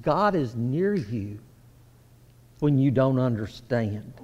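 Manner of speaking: low, soft, tender promise; quiet reassurance. Acted. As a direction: lean in and reassure them softly.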